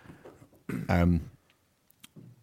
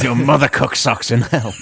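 mock demon line; 4 semitones higher, 7 dB louder than usual. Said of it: "It's called The Dyslexerist".

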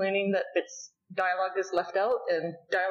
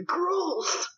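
mock disgusted shriek; 10 semitones higher than usual. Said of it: "Shinpachi, too, is".

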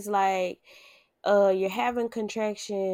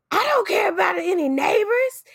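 louder, much higher angry yell; yelling back; 10 semitones higher, 6 dB louder than usual. Retold or imitated. Yelling at the mother-in-law about the neighbors, loud and high. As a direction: snap back at her in pain. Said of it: "And I was like".

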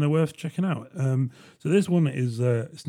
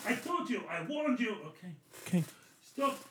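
nineties action-movie Russian villain, much higher and softer; cheesy Russian warning, hammed up; bad Russian accent; 8 semitones higher, 9 dB quieter than usual. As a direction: Petrov returns to warn the host in a bad Russian accent. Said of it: "stereotypical shitty accent".

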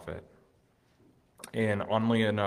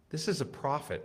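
usual speaking voice

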